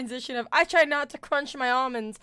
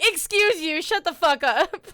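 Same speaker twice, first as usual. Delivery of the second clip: mock telling off; 6 semitones higher, 5 dB louder, slower than usual. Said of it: "And then I'm like".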